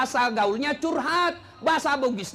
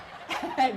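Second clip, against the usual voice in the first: laughing; 3 semitones higher than usual. Real, not acted. Preacher laughs at own joke.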